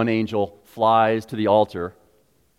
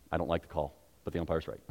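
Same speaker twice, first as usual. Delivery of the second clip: grudging concession; 6 semitones lower, 10 dB quieter, much faster than usual. Retold or imitated. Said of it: "I'd be like".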